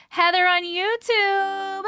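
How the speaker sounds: high, drawn-out singsong call. Real, not acted. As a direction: call for someone, stretching the last word. Calling out for a missing viewer.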